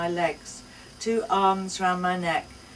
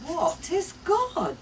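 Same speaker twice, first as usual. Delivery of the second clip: put-on slow, higher little-girl question; lightly voiced insisting child; 11 semitones higher, 3 dB louder, slower than usual. A narrator's reading. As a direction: ask slowly and earnestly like a small girl. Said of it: "Tess insists".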